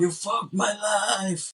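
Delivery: high, drawn-out imitation of a furious man; mock angry yell. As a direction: wail it slowly, playing up the outrage. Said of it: "he starts yelling at, yelling at her".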